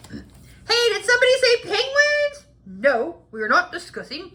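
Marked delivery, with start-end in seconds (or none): 0.1-2.5 high, loud eager call; excited shout. Acted. Penguin fan yelling into the talk, high and eager. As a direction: leap in shouting with hope.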